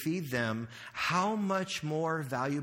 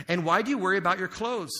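loud, higher scripture line; proclaiming scripture; 4 semitones higher, 6 dB louder than usual. Acted. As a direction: lift the verse up to the hall. Preaching scripture.

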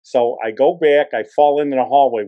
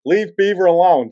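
mild mock yell, raised pitch and volume; imitating girls yelling in protest; retold yell; 5 semitones higher, 5 dB louder than usual. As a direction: call it out like girls yelling, without a full shout. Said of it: "these girls are yelling".

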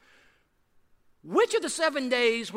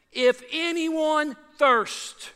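drawn-out, raised call; slow raised cry of Jesus; cried out; 5 semitones higher, 5 dB louder, much slower than usual. Acted. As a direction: call it out slowly to the crowd. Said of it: "It says he cried out".

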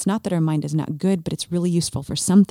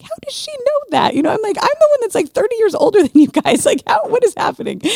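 much higher, louder mock disbelief; incredulous; 6 dB louder than usual. Retelling jealousy at a teen's gift, high mock disbelief. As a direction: squeal it like you can't believe it. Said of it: "And I'm like".

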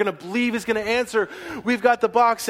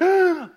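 sharp gasp; 12 semitones higher, 5 dB louder than usual. Acted. Scout sees the ark.

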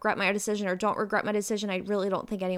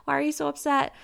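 mom, voiced much higher, puzzled; mother asks daughter why she is upset, higher mock voice; imitating mom; 8 semitones higher than usual. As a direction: play mom gently questioning the fuss. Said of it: "she was like".

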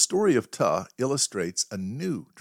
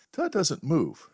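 ordinary talk

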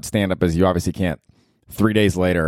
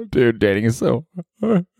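hammed-up mock sobbing; fake cry; 5 semitones higher than usual. Lamenting dating, a hammed-up fake cry. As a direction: pretend to cry over dating. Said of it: "was a fake cry".

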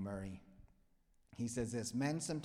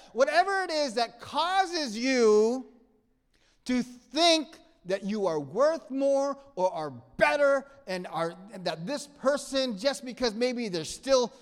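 much higher and louder, slow; preaching at full force; 12 semitones higher, 16 dB louder than usual. Acted. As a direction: push it out like a revival preacher.

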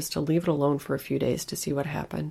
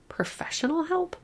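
frantic whisper; 13 semitones higher than usual. Acted. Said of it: "I thought frantically".

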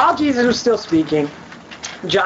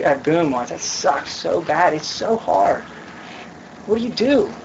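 ordinary talk, nothing marked

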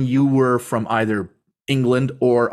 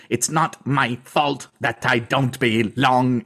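over-enunciated; slower than usual. Retold or imitated. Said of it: "in a very over-enunciated way".

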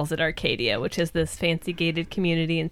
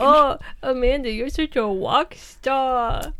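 slow patronizing sing-song; baby voice; 5 semitones higher, slower than usual. Retold or imitated. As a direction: coo it at a coworker. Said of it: "says it to me in a baby voice".

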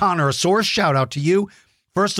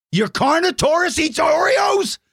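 amazed, almost shouting; surprised; 12 semitones higher than usual. Real, not acted. Amazed at kid's toy.

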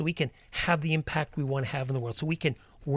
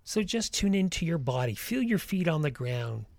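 guided meditation. Acted. Leads a walking meditation.

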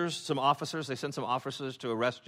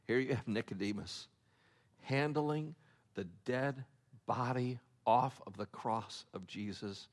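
solemn hush; 5 semitones lower, 8 dB quieter than usual. Real, not acted. Preacher reverently telling the congregation of Jesus's burial. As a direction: lower the voice for the burial.

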